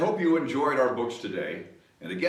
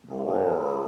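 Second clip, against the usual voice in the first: lion roar; 10 semitones lower than usual. Shown as a demonstration. Imitating lion.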